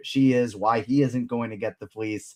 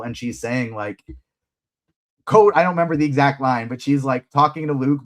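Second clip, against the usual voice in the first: ordinary talk, nothing marked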